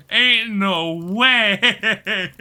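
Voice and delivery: drawn-out, slow laughing "ain't no way"; incredulous laughing drawl; laughing disbelief. Real, not acted. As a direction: stretch it out while laughing. Laughing disbelief at chat story.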